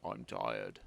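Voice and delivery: put-on tired old toad. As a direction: groan it like a worn-out toad. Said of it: "said the toad".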